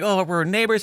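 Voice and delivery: high, imitating a delighted neighbor; lightly voiced cheerful neighbor; happy discovery. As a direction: toss it up like a pleasant surprise. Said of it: "it was like".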